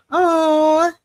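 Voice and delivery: high sudden squeal; startled little yelp; surprised. Real, not acted.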